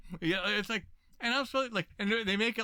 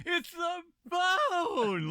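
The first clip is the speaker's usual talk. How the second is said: high, slow, laughing shout; excited; 4 dB louder, much slower than usual. Retold or imitated. Actor thrilled by a dog show, shouting with glee. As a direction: squeal it with pure delight. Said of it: "he's, like, all excited".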